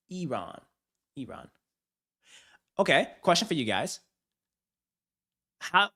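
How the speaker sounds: ordinary talk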